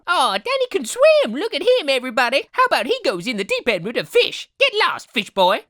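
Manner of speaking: high, mocking kid voices; jeering classmates. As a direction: taunt him like a mean schoolkid. Acted classmates jeering at Danny.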